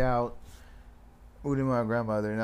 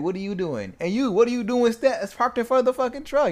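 angry; 12 semitones higher, 6 dB louder than usual. Retold or imitated. Yelling at coworkers.

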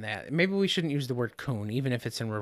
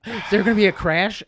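higher startled quote after a gasp; retold alarm; 6 semitones higher, 4 dB louder than usual. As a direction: act out your own surprise at a near crash. Retells own startled reaction.

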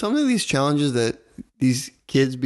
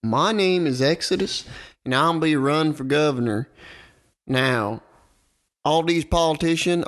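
hammed-up Southern stump speech; mock politician with a Southern accent; bad Southern accent; 3 semitones higher than usual. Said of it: "That was the worst Southern accent".

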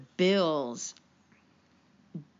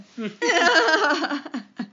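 laugh; 10 semitones higher, 7 dB louder than usual. Real, not acted. Laughing at her own surfer act.

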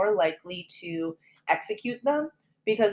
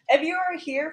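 laughing; 10 semitones higher, 8 dB louder than usual. Real, not acted. Laughing welcome.

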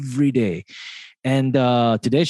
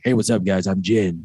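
low-pitched, laid-back radio self-introduction; playing it cool; 5 semitones lower than usual. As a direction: introduce yourself casually, keeping it low and cool. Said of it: "It's cool to say Jin".

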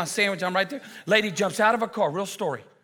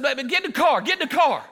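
acted urgent command; 8 semitones higher, 4 dB louder than usual. Ordering angry customer back to car.

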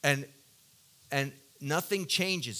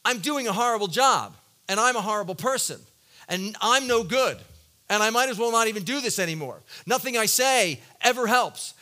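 loud, higher, self-blaming rant; despairing inner voice; 5 semitones higher, 7 dB louder than usual. Retold or imitated. Preacher acting out his own despairing thoughts, loud and pleading. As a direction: spill out the spiral of self-condemnation. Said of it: "And then I translate that into".

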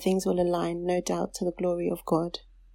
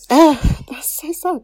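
tag emotional; 8 semitones higher, 10 dB louder, slower than usual. Real, not acted. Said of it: "what is wrong with me".

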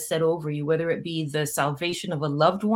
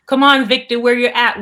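loud, raised, rallying call; high-energy coaxing holler; calling someone out; 5 semitones higher, 9 dB louder than usual. Real, not acted. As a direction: summon him to join in. Calls on viewer to join in.

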